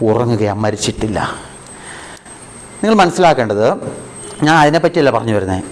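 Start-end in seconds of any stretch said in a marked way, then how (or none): 0.1-1.2 quiet Jesus voice. Acted. Jesus's reply, quoted by the preacher.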